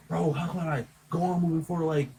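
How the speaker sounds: high-pitched, wounded complaint; upset friend. Retold or imitated. Teller voicing his client's grievance over the stolen girlfriend, high aggrieved imitation. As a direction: imitate a betrayed man venting, pitched well up.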